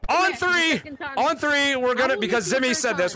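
usual speaking voice

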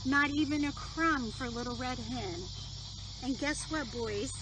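gentle storybook reading voice; reading a story to children. Acted. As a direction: read it like bedtime story time.

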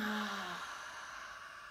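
long soft yawn-sigh; soft release on a yawn. Shown as a demonstration. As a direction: let out a big yawning sigh. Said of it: "And just yawning".

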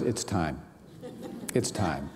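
low, soft, slow inner knowing; hushed inner voice. Retold or imitated. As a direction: say it like a quiet certainty inside.

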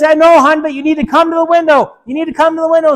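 high, loud, urgent instructions; urgent father. Acted. Father urging his daughter toward the window, loud and urgent. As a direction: push her to move now.

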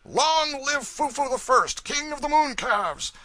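high, drawn-out mocking cheer; roaring crowd shout. A narrator's reading. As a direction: roar it out like a whole crowd. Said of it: "a roaring shout went up".

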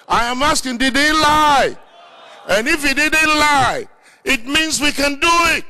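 high, insistent preacher's delivery; fervent preaching challenge. Acted. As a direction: press the congregation for an answer. Challenges congregation in full preaching.

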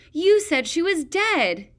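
high, loud, slow shriek. A narrator's reading. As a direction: scream it at her. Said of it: "Peril shrieked".

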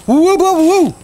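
real joyful hollering; excited whoops. Real, not acted. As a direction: cheer the flip like you won. Pizza flip succeeds.

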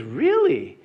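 high, amazed really. Acted. Newcomer hears pastor is famous, high amazed really. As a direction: squeak it out in disbelief. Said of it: "And the guy goes".

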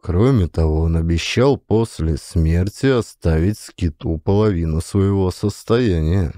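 ordinary delivery, nothing marked

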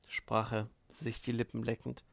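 usual speaking voice

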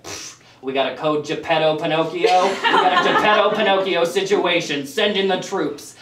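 urgent park staffer radioing in; mock-official emergency radio call; uppity walkie-talkie dispatcher. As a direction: sound the alarm over a walkie-talkie. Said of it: "uppity cast member on a hot walkie-talkie".

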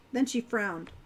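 plain reading voice

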